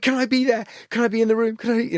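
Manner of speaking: excited pleading. Retold or imitated. Wife begging to join the recording, imitated eagerly. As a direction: bounce with excitement. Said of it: "like, so excited".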